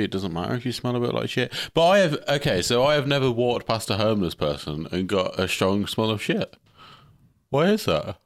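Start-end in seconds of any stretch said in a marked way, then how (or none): 6.2-8.2 high-pitched, baffled questioning; oddly emphatic puzzlement. Real, not acted. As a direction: puzzle over it in a raised, exaggerated voice. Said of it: "Why are you saying it like that".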